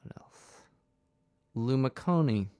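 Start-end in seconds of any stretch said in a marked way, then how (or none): 0.0-0.6 whispered aside to self. Real, not acted. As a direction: breathe it under the breath. Muttered aside while reading.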